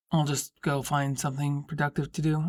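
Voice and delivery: low voice for Drew; male character voice. A narrator's reading. Drew's line.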